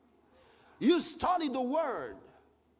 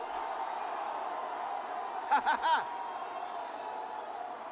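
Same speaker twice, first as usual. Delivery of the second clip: breathy laugh; 3 semitones higher than usual. Real, not acted. Laughing after the whoop.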